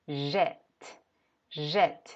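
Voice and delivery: jet with an open è; open e sound. Shown as a demonstration. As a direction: show the open vowel in jet. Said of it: "sino que va a ser".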